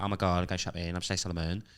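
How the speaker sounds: softly put-on [name]-style accent. Shown as a demonstration. Comedian showing an old school talent show act, softly put-on celebrity accent. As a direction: lightly imitate a celebrity's accent, low-key. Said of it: "you just put this accent on".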